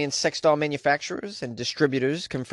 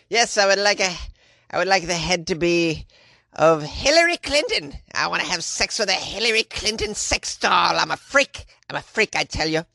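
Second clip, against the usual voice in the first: hammed-up pervy customer voice, pitch swinging higher; mock customer; 4 semitones higher than usual. Host mocking a customer asking for custom doll heads, hammed-up. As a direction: play a silly freak placing an order.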